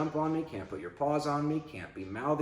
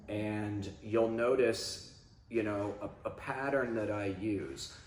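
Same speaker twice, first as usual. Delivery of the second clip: usual speaking voice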